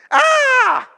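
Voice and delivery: high, piercing scream of panic; retold child scream. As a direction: shriek like a terrified child. Kids panic at threat.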